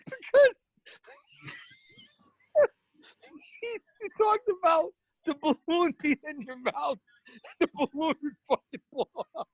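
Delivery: words broken by laughter; laughing hard mid-sentence. Real, not acted. Laughing while retelling.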